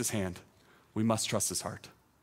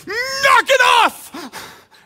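huge, high, drawn-out shout; commanding yell; 13 dB louder, much slower than usual. Acted. Preacher yelling at pouring rain to stop, commanding yell. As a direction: bellow it at the sky, stretching the words. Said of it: "Jesus yells at the weather".

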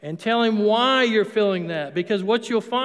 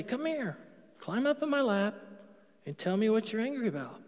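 soft, gentle fatherly call; tender invitation; 7 dB quieter than usual. Pretend. God as loving Father inviting an angry child, tenderly. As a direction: coax a child into your lap. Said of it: "He just wants to say".

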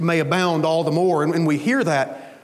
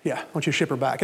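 the same words again, much softer and dropping away; quieter repeat; 6 semitones lower, 5 dB quieter, much faster than usual. Retold or imitated. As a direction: settle it under your breath, resigned. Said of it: "and he said".

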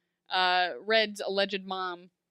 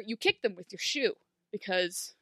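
usual speaking voice

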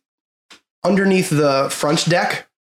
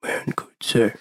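whisper; 4 semitones lower, 5 dB quieter, slower than usual. Acted. Half orc echoes, whispering.

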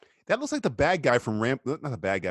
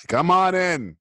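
calling out; 5 semitones higher, 6 dB louder, slower than usual. Pretend. Calling to movie character.

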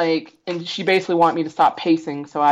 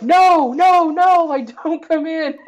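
high, loud mock yell of no, no; retold yelling; 12 semitones higher, 3 dB louder, slower than usual. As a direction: imitate staff yelling to keep her out. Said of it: "they're, like, yelling at her".

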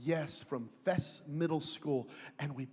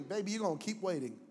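ordinary talk, nothing marked

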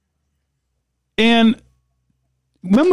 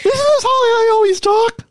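very high, mock-wailing protest; funny put-on voice; 5 semitones higher than usual. Podcaster insisting to cohost it is his normal voice, high mock wail. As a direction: whine it up high in pretend indignation. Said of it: "my funny voice".

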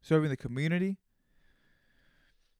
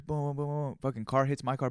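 normal talking voice

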